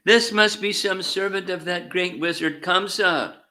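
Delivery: high-pitched, distressed cry; distressed lament. A narrator's reading. Gopis crying out around Krishna, in distress. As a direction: cry it out high in alarm, held up to the end. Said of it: "the gopis cried loudly in distress".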